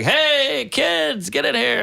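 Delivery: put-on high, drawn-out call to the kids; warm parent calling. Imagined parent to the kids, calling them in warmly. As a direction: imitate an affectionate parent calling children over.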